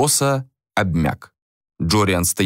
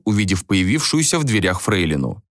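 ordinary delivery

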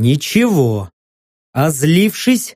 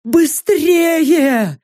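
frightened shout; 12 semitones higher than usual. Acted. Saka urging in the act.

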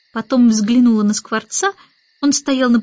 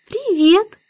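put-on much higher little-girl voice; baby Annabel, friendly; 10 semitones higher than usual. A narrator's reading. Baby Annabel greets the starling, high and friendly.

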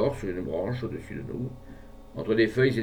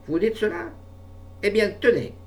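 outraged; 11 semitones higher, 4 dB louder than usual. A narrator's reading. Outraged before killing ferret.

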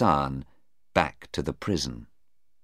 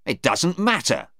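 angry; 10 semitones higher, 3 dB louder than usual. Acted. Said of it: "Stephen said angrily".

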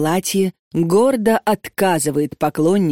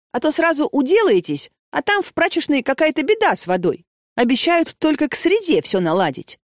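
put-on high, chatty young woman; eager hostess offering pies; bubbly girl voice; 5 semitones higher than usual. Vika's voice.

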